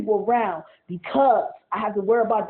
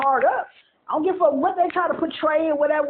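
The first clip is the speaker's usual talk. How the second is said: normal talking voice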